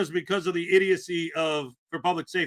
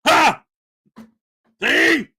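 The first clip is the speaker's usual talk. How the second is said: high, loud gloating; mock triumph; 8 semitones higher, 8 dB louder than usual. Vlogger mimicking the auditor gloating, high and loud. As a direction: crow like you caught him.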